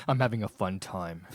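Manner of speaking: forced cheer through clenched teeth; through gritted teeth. Acted. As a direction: grin through the pain, jaw tight. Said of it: "through gritted teeth".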